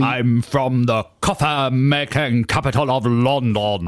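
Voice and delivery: slow, hammed-up Lord of the Manor accent; plummy toff, slowly; mock posh British. Co-host answering in a hammed-up posh British accent. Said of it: "started talking like that".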